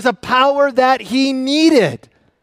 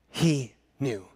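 He knew repeated much softer and lower; hushed echo; 9 semitones lower, 10 dB quieter than usual. Shown as a demonstration. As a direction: let He knew fall away into a hush.

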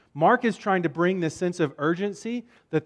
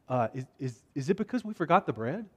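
soft, stammered question; timid guess; 4 semitones lower, 8 dB quieter than usual. Acted. Disciples guessing why Jesus warned them, timid. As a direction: venture it quietly, unsure.

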